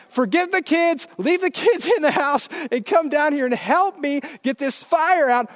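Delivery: high-pitched frantic yell up at a window; retold yelling. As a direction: yell urgent orders to your wife. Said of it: "I'm yelling up at her".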